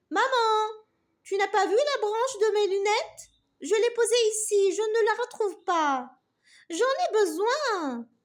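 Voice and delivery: put-on loud, high child calling mom; little girl calling. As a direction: call out for mom, worried. Fillette appelle sa maman. (fillette qui appelle sa maman)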